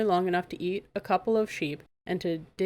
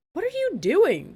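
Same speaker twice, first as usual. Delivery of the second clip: much higher, louder, slow scream; 13 semitones higher, 7 dB louder than usual. A narrator's reading. Dragon screaming at Megan taking notes, outraged scream. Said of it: "the dragon screamed at her".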